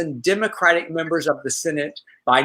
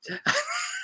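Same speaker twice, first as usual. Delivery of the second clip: laugh; 10 dB quieter than usual. Real, not acted. Laugh after quote.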